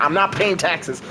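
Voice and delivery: usual speaking voice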